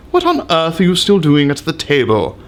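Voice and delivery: usual speaking voice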